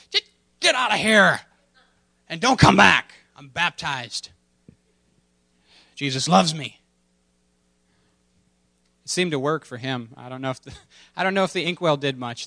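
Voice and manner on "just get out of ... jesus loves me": higher, louder, commanding the devil away; defiant shout. Retold or imitated. Luther yelling at the devil, defiant loud shout. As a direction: drive the devil out of the room.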